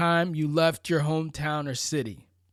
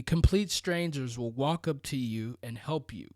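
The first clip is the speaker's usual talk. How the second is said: normal talking voice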